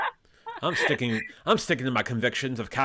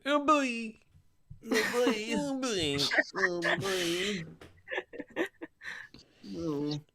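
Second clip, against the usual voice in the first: silly catchphrase voice; 6 semitones higher, much slower than usual. Pretend. Catchphrase repeated in put-on voice.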